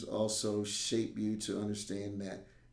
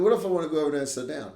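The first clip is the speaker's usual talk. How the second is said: put-on fast, louder, higher childish plea; a kid hurrying to ask his teacher; quick kid voice; 6 semitones higher, 9 dB louder, much faster than usual. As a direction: rattle it off like a kid begging. Kid asking to sit out.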